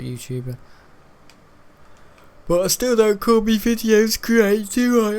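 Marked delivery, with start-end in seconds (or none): 2.5-5.2 high, louder jokey voice; high squeaky mock voice; silly put-on voice. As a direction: put on a daft voice.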